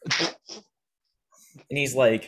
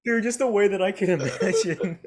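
high-pitched, talking through laughter; laughing; 7 semitones higher than usual. Real, not acted. Podcaster tickled by his own impression, laughing. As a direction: barely get it out while cracking up.

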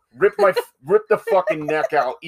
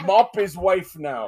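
put-on Russian accent; 5 semitones higher, slower than usual. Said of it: "we only can do Russian accent".

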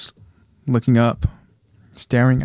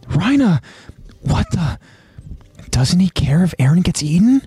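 high, halting disbelief; acted dismay, pitched up; aghast disbelief; 7 semitones higher than usual. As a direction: voice it as a shocked young soldier. Armin aghast at armored Titan's act.

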